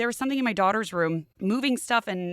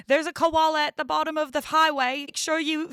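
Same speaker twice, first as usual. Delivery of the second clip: higher-pitched, badly faked Australian; bad Australian accent; 7 semitones higher, 3 dB louder than usual. Traffic warning about a koala, bad mock Australian accent. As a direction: attempt an Australian traffic announcer and miss. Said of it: "that was not an Australian accent either".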